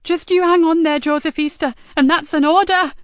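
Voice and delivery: high, loud firm order; pleading sergeant's shout; desperate command. Acted. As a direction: order him to hold on. Nicky ordering him to live.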